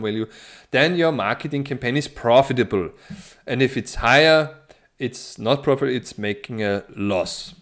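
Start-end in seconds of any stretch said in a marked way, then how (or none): none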